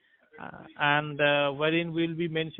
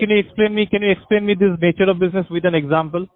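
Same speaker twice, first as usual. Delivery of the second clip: louder, pressing and talking over them; loud insistent question; 9 dB louder than usual. Real, not acted. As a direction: push in firmly for an answer. Pressing for an explanation, interrupting.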